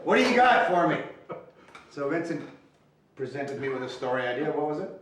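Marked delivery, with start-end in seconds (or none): 0.0-1.0 high, loud call to a colleague; retold eager demand. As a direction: call out expectantly for the goods. Calls on colleague for ideas.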